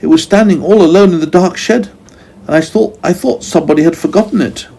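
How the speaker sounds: high, quick startled voice; alarmed Meddle. A narrator's reading. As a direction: caught out and flustered. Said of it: "cried Meddle in alarm".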